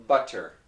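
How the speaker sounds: "butter" with a hard, puffed T; clear T in butter. Shown as a demonstration. Teacher showing what nobody says, "butter" with crisp T. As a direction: say "butter" with a fully released T. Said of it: "But nobody says butter".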